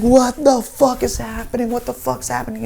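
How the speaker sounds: high-pitched alarm, loud then easing; panicked bewilderment. Retold or imitated. Teller hearing the wail every six hours, panicked. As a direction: cry it out high, utterly baffled.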